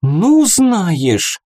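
loud, high offended fairy. A narrator's reading. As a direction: bristle with offence. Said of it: "не на шутку обиделась".

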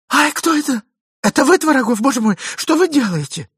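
high, loud, startled alarm; startled cry. Acted. Lady seized by the hand, startled. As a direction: shriek in fright.

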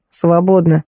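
a touch of gruff indifference; narrator's understated grumble; grumbled, curt nurse. A narrator's reading. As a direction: grunt it out in one word. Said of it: "буркнула".

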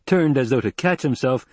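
plain reading voice

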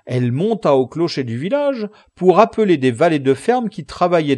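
loud, hearty, exuberant peasant squire; boisterous rustic Sancho. A narrator's reading. Sancho boasts to his master of village girl Aldonza, loud and boisterous. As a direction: belt out the praise with earthy relish.

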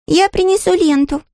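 put-on high, childish cry; eager exclamation. Mira and Gardar volunteer at once, high childish shout. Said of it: "в один голос воскликнули Мира и Гардар".

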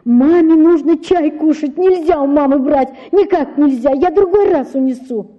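put-on high, urgent child's pleading; begging father not to take money; высокая отчаянная мольба; desperate pleading. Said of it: "умоляя его".

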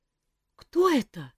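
high, slow scared chick squeak; frightened squeak. A narrator's reading. Chick hears a distant voice, high frightened squeak. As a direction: peep it out in fright. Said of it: "испуганно пискнул цыплёнок".

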